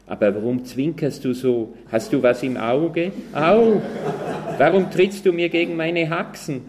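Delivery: high, loud, dopey pig voice with a yelp; clueless pig, rising to a pained cry; simple-minded pig. A narrator's reading. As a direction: naive pig squealing about the kick. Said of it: "sagte das Schwein einfältig".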